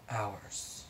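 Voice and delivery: usual speaking voice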